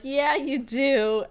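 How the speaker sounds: high, loud, drawn-out mock infatuation; lovestruck gushing. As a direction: stretch it out like a smitten kid. Retelling Dipper's lovestruck reply.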